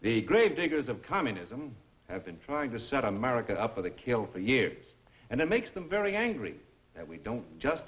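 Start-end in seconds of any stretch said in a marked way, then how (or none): none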